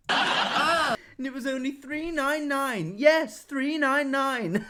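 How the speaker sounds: imitating the adverts. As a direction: ham up a TV advert pitch, slowly, with a laugh. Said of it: "He sounds like the adverts".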